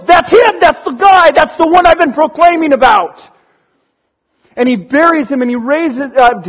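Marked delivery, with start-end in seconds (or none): 0.1-3.2 higher and louder, thrilled to point him out; excited announcement. Acted. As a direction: tell them eagerly that it's him. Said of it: "he tells his disciples".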